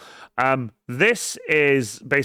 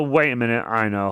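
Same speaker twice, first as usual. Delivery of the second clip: usual speaking voice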